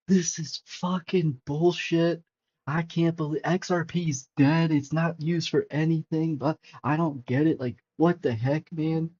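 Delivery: mock panicked rant, first words dragged out slowly; dad freaking out. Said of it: "He starts freaking out".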